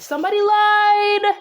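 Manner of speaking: high, loud, drawn-out call-out; playful sing-out accusation at full volume; mock accusation. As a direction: holler it slowly, holding the last word. Mock accusation about the characters.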